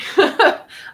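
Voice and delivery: a sudden loud laugh, high and bright; burst of real laughter. Real, not acted. Mother cracks up at her son's answer, a loud real laugh. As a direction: laugh out loud, caught off guard.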